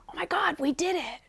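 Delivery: hushed, breathless whisper; awed whisper. Retold or imitated. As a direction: whisper it in stunned wonder. Inner child stunned by news.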